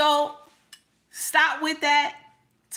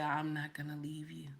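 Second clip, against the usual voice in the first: whispered promise; 7 semitones lower, 14 dB quieter than usual. Retold or imitated. Quoting king's tender promise.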